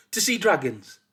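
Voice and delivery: high, loud eager guess; hopeful wrong guess. A narrator's reading. Forgetful son guessing his errand for father, hopefully. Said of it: "couldn't quite remember".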